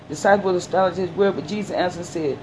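ordinary talk, nothing marked

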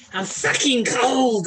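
high, drawn-out mock Boston moan; badly faked Boston accent, stretched out; exaggerated American complaint; attempted American accent. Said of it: "I can't do American very well".

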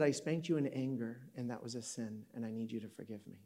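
soft, low, sinking to near silence; hushed apology. Retold or imitated. Dad apologizing to his young son, hushed. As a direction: let a retold apology drop away quietly. Father's tearful apology retold.

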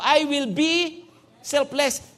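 slow, deliberate "I will be selfless"; slow declaration. Shown as a demonstration. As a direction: say "I will be selfless" slowly, every word weighed. (dahan-dahang pagbigkas ng "I will be selfless")

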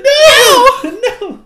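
squeaky high cookie voice, laughing; shrill little cookie cry through laughter; mock panicked gingerbread man; Gingy. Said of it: "Ginger's like".

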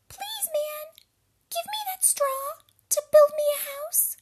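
very high-pitched, squeaky pig voice; little pig. A narrator's reading. Pig begs a man for straw, very high squeaky voice. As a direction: squeak it out as a tiny piglet. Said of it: "The first that went off".

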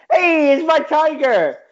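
high, loud put-on accent, delighted; Mike Tyson. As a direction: exclaim it in a silly high-pitched accent. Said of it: "I gave that accent to Mike Tyson".

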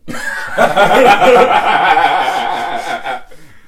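high, drawn-out cackle of laughter; long loud laugh. Real, not acted. Podcaster cracking up at an absurd description, long loud laugh. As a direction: burst out laughing and keep it going.

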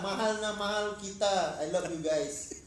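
put-on playful Filipino-accented declaration of love. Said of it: "Farouk in his, uh, Filipino accent".

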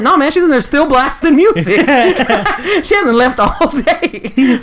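high-pitched, laughing punchline; amused exaggeration. Spinning the sister story for the receptionist, high and laughing. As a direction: push the tall tale up high through laughter.

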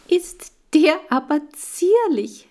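put-on high, slow, sweet girl's coo; high delighted girl voice, drawn out; cooing little girl. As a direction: gush over the tiny hamster. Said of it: "rief sie".

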